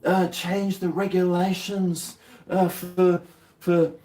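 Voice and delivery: high, slow, official announcer voice; mock news voice. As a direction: ham up an official warning. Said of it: "all those things".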